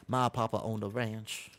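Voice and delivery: slow, lightly put-on cowboy accent that slips into another accent; mock cowboy accent. Streamer sounding like a cowboy for chat in a wobbly mock accent. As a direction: play a rancher's son in a half-hearted cowboy accent. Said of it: "that's a totally different accent".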